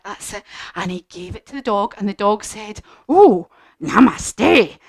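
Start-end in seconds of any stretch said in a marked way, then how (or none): 3.1-4.8 slow, drawn-out thankful namaste; grateful dog. Acted. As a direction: stretch it out like a relieved dog.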